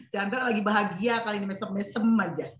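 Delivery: usual speaking voice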